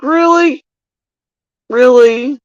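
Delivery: high, disbelieving really; incredulous really. Real, not acted. Reacting to the inept neighbours, high incredulous disbelief. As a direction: ask it in pure disbelief.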